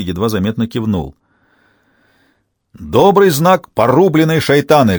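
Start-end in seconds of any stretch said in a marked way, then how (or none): 2.8-5.0 loud, higher-pitched proclamation; sudden bark. A narrator's reading. Fierce priest startling soldiers from behind, barked. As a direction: thunder it to make them jump. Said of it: "гаркнул за спинами стрельцов отец Никон".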